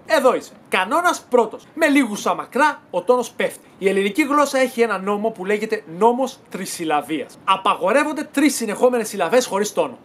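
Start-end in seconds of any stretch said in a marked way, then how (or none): none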